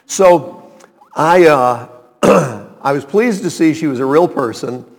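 normal talking voice

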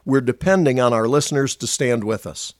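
brisk, upbeat announcer read; radio ministry appeal read. Acted. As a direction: pitch the ministry warmly to listeners.

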